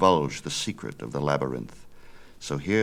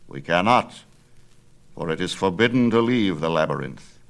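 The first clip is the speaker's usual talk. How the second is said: put-on a louder, firm old craftsman; old father refuses his son, louder and firm; old Daedalus; 5 dB louder than usual. Father answers his son, firm old man.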